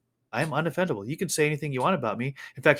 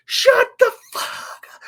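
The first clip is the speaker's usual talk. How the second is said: high, loud, slow furious mock shout; heated mock yell at the singer; imitating an angry yell; 6 dB louder, slower than usual. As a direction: spit it out like an angry heckler. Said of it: "with great enthusiasm, with great heat".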